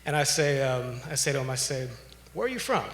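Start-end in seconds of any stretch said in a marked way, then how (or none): 2.3-2.8 asked up high, lightly calling out to a stranger; an open, higher-pitched street greeting, not a threat; raised friendly question. Retold or imitated. Approaching the angry man, asking.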